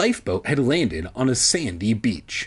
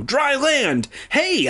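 high-pitched calling out; hammed-up castaway holler; excited cry; 12 semitones higher, slower than usual. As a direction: cry out the sighting to everyone. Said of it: "I cried".

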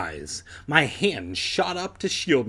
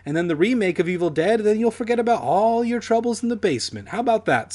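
fatherly coaxing; 8 semitones higher, 3 dB louder, faster than usual. Acted. Voiced dad soothing son.

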